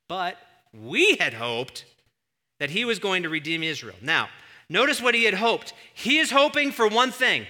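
loud, high preaching that keeps climbing; full-force preaching. Real, not acted. Preacher pressing the congregation on a false hope, loud full-force preaching. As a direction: drive every phrase home at high volume.

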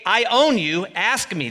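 loud, high proclamation; preaching at full force. Acted. Preacher proclaiming God's words to the Messiah, loud and high. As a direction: declare it with full authority.

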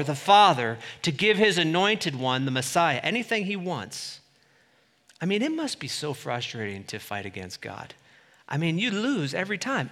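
usual speaking voice

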